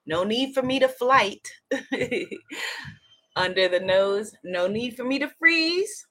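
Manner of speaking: low, calm affirmation voice; guided tapping affirmations. Acted. Leads a tapping affirmation round.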